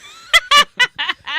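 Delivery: squealing high laugh. Real, not acted. Laughing at own impression.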